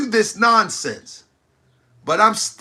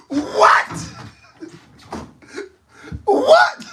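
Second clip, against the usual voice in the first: stunned what; 14 semitones higher than usual. Real, not acted. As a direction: shoot up high in disbelief. Reacting to prostate talk.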